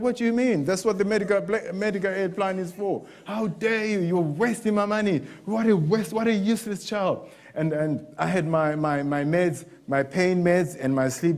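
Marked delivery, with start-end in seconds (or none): 0.0-7.3 a high-pitched, scornful father's tirade; imitating an angry father, pitched up; dad ranting. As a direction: rant at the son like a furious dad. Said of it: "It's like".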